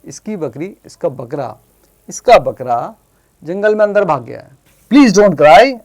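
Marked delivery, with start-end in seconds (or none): 4.9-5.8 lightly voiced squirrel, louder and higher; squirrel's plea. Squirrel comforts the crying boy and rabbit, in a raised pleading voice. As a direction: plead emphatically, as a storybook squirrel. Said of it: "अब squirrel बोल रहा है".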